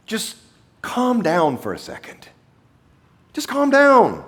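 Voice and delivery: mock impatient reassurance; dismissive. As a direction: wave her grief aside. Imagined brisk Jesus dismissing grief.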